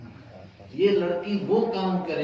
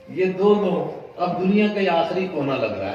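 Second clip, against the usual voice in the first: emphatic preaching; 5 semitones higher, 7 dB louder than usual. Acted.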